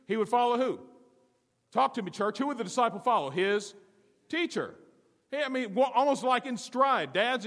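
high, loud, urgent sermon; full-force preaching. Real, not acted. Pastor exhorting his congregation, high and loud. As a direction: drive it home to the church.

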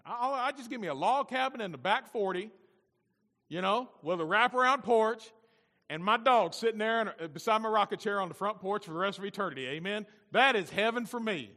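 high, loud, eager preaching; fervent enthusiasm. Real, not acted. Pastor describes his log-cabin heaven to the congregation, excited and loud. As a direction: revel in the homely daydream out loud.

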